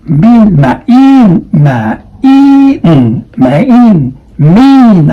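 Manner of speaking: mean drawn out as ma'in, high and loud; mean, ma'in, ma'in, stretched; exaggerated mean. Shown as a demonstration. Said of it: "according to the I P A symbols".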